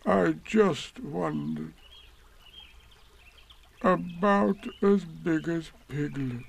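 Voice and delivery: slow, high, sorrowful donkey voice; sad. A narrator's reading. Eeyore musing sadly to himself about Piglet's size. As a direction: sigh it out, gloomy and slow. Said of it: "he said to himself sadly".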